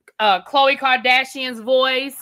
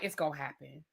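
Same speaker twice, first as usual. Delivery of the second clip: a soft, knowing mama, much quieter; mama's quiet certainty; 8 semitones lower, 15 dB quieter than usual. Retold or imitated. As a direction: murmur it like a sure mother. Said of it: "Mama like".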